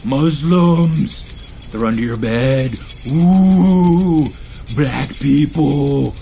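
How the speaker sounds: loud hammed-up fearmongering news voice; mocking imitation of a scaremongering news channel; mock infotainment anchor. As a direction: whip up panic like a cheap cable channel, drawing out each Ooh. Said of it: "Here's my imitation of most modern infotainment channels".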